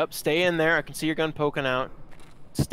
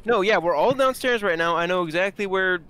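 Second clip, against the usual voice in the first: higher, louder excited callout; yelling across the room; 5 semitones higher, 5 dB louder than usual. Real, not acted. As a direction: shout the position over the noise. Calling out position to team.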